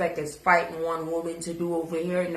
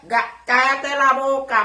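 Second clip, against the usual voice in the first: loud shut up; 5 semitones higher, 6 dB louder than usual. Real, not acted. Telling viewer to shut up.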